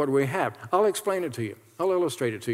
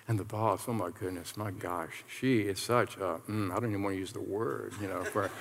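hushed, fast, much lower gossip; conspiratorial aside, much softer; gossiping coworker; 6 semitones lower, 6 dB quieter than usual. Acted. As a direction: coworker agreeing in a low, rushed whisper-like murmur. Second coworker agreeing, gossiping.

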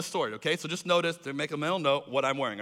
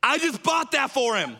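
insisting; 6 semitones higher than usual. Retold or imitated. Tim insists.

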